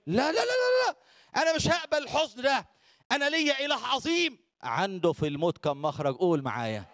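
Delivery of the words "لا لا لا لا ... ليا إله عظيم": defiant David. Acted. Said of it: "داوود يقول لهم إيه".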